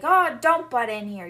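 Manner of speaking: loud, irritated brush-off; a father barking at his daughter to butt out; annoyed snap. Retold or imitated. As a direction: shut her down sharply. Father snaps at interrupting daughter.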